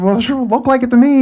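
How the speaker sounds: high-pitched defensive protest; retold huffy comeback; defensive retort. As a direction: argue back, acting out the indignation. Retold argument with the officer.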